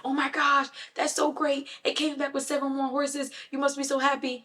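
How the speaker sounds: overjoyed villagers cooing over the good news; gushing neighbors. Retold or imitated. Neighbors gush over the horses.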